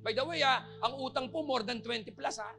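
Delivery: loud, high revival preaching; full-force preaching. Acted. Pastor preaching at full force to the church. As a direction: fires up the congregation.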